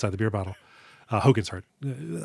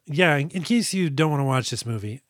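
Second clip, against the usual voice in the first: ordinary delivery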